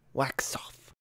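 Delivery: wax off whispered, almost breathless. Retold or imitated. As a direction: let it fade into a whisper. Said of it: "an iconic scene from The Karate Kid".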